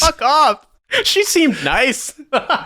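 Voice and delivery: high, laughing mock indignation; laughing protest. Real, not acted. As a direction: push back on the teasing through laughter. Laughing protest at friend's teasing.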